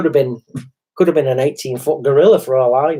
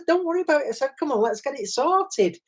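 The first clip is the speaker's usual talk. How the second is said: reassuring; 12 semitones higher, 5 dB quieter than usual. Retold or imitated. Reassuring the girl, retold.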